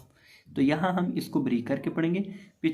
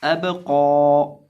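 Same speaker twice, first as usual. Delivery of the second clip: कौ stretched very long and loud; drawn-out कौ in recitation; long drawn vowel; 7 dB louder, much slower than usual. Shown as a demonstration. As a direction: hold the vowel of कौ out for a long time. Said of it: "कौफ खड़ी ज़बर कौ".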